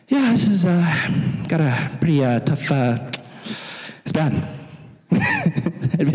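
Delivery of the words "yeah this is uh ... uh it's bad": halting, deadpan understatement; unruffled understatement. Retold or imitated. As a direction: play it cool in a crisis with long hesitations. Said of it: "And I'd just be like".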